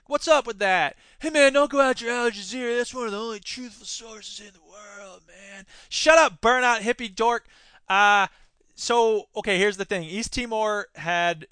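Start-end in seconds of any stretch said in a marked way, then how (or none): 1.2-5.7 lazy burnout hippie protesting; a lightly voiced laid-back hippie. Imagined hippie defending Al Jazeera. 5.9-7.5 loud, higher mock retort; mock-angry comeback to the hippie; mock snap. Snapping at imagined hippie.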